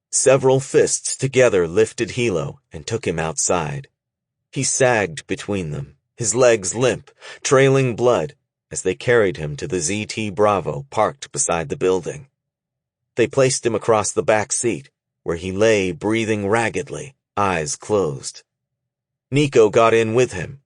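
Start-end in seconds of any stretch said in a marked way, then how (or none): none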